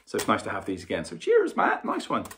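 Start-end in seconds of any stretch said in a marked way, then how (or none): 1.0-2.2 cheery thanks. Real, not acted. Thanking friend for brushes.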